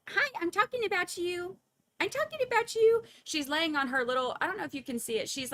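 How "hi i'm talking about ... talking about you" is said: high, loud squeaky pet voice, rising further; cat's voice. Pretend. Owner voicing her nosy cat, high and loud. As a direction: voice the cat calling out from her rug. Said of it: "And look, she's".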